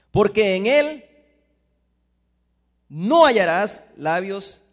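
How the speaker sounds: loud, slow proclamation; scripture read with force. Acted. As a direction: lay the words down slowly and loudly. Continues proclaiming the verse. (proclamación lenta y fuerte del versículo)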